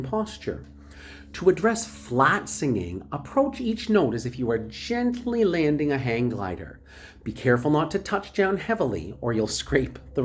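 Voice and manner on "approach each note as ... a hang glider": normal talking voice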